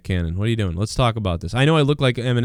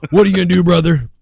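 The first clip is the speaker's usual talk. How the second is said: higher and louder wrestling-promo bellow; wrestler catchphrase; 6 semitones higher, 6 dB louder, faster than usual. Retold or imitated. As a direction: roar it like a pumped-up pro wrestler. Hulk Hogan catchphrase after Hogan talk.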